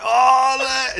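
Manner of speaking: can barely hold it in; excited nudge. Retold or imitated. Laughing teller nudging the girl beside him, overexcited. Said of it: "I turned to a girl next to me".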